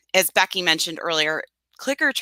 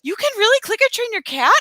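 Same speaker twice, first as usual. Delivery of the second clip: surprised; 14 semitones higher than usual. Retold or imitated. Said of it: "my clients are all like".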